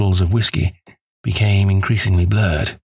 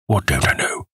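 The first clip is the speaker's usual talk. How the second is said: anxious; 5 semitones lower than usual. A narrator's reading. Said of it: "I asked anxiously".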